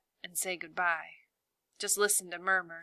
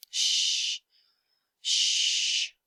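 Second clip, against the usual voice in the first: shushing like Dora; 3 dB louder than usual. Retold or imitated. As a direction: hush the baby gently. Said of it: "I say like Dora".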